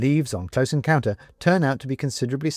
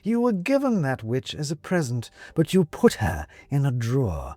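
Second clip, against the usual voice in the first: sly cat; 6 semitones higher than usual. Acted. Cat mocking.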